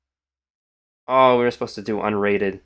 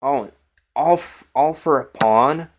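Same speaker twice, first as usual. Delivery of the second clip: surprised; 5 semitones higher, 4 dB louder than usual. Real, not acted. Spots surprising engine line.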